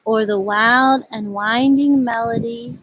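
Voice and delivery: gentle, unhurried read-aloud poem voice; slow, tender picture-book reading; reading to children. Acted. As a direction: read it like storytime for little kids. Reads picture-book poems to children.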